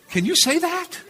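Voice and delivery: high, laughing astonishment; shocked disbelief. Retold or imitated. Young student asking the apostle, laughing in shocked disbelief. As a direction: squeak it out in amused shock. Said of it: "I said".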